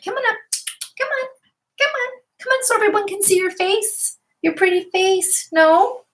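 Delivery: very high sing-song pet voice. Real, not acted. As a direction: coo at the dog to show its face. Coaxing the dog on camera.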